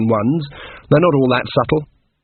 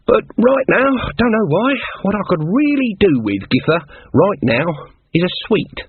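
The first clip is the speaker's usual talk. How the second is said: higher, pointed and deliberate; stern Granny Weatherwax; 7 semitones higher than usual. Acted. A lightly voiced stern witch hinting to Nanny.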